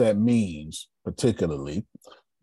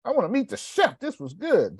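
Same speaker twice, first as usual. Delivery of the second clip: high-pitched eager diner calling for the chef; excited, high mock-customer voice; enthusiastic customer. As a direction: call out in a much higher voice, full of praise. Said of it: "when they come and say".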